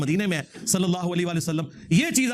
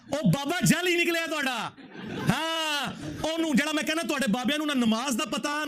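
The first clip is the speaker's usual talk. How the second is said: high, scornful mockery in Punjabi; mocking ridicule; 6 semitones higher than usual. Real, not acted. Preacher mocking a rival sect's saint, scornful and high. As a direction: jeer at the fake holy man from up high.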